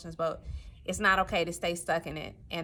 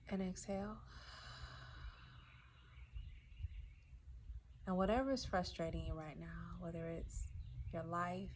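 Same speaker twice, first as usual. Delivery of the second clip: soft, hushed meditation voice with long pauses; quiet breathing instructions, left to settle in silence; guided meditation; 9 dB quieter than usual. Acted. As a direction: guide them gently through each breath, voice growing much softer.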